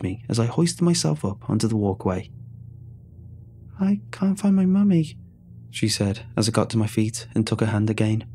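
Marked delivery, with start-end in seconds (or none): 3.8-5.2 put-on high, tiny child's voice; a lost child saying it once more; little girl voice. A narrator's reading. Lost girl repeats to the rescuer, tiny high voice.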